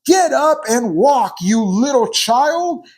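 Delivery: mock furious parent yelling at a toddler who fell; pretend angry yell. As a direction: bark it down at a fallen child like a harsh parent. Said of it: "I yelled at her and I said".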